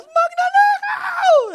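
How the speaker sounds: long high yelling cry of thief; shouting thief. Retold or imitated. Mother yelling from the window to wake the neighbours, screaming. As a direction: scream it out the window at the top of your voice. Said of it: "Top of her voice".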